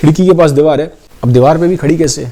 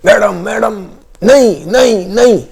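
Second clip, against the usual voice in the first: desperate shout; 8 semitones higher, slower than usual. Acted. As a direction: call out in panic to stop her. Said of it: "पीछे से मेड चिल्लाती है". (घबराकर ऊँची आवाज़ में चिल्लाना)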